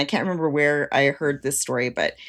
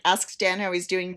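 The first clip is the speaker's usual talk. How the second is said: ordinary talk, nothing marked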